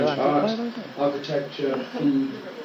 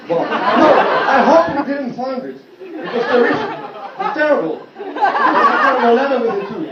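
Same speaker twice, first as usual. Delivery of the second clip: laughing; 10 semitones higher, 4 dB louder than usual. Real, not acted. Embarrassed laughing confession.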